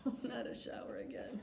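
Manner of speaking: whispered aside. Real, not acted. Owner whispering a tired aside about showers. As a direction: mutter it in a whisper.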